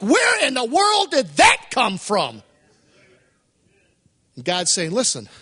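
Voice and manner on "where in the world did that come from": amazed. Retold or imitated. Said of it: "And you're amazed".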